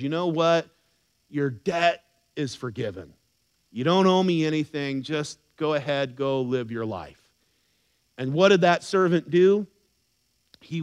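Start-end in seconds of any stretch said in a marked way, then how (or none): 0.0-7.2 gentle forgiveness. Acted. Master forgiving the servant.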